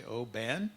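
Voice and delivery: Oban ending in an open "ban"; second syllable of Oban stretched as "ban", like can; mispronounced Oban. Shown as a demonstration. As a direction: say Oban as "oh-BAN", rising at the end. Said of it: "but it's Oban".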